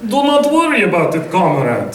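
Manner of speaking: a light Russian accent pitched high on worry. Shown as a demonstration. Voice actor warming up into his Russian character, light Russian accent. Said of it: "one of the ones I used to do for an accent of mine".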